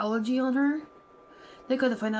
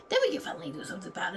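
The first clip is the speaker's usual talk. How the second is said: much higher and very fast; mock cops; 10 semitones higher, much faster than usual. Mocking the police to viewers, high rushed mock. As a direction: rattle it off like a dismissive cop.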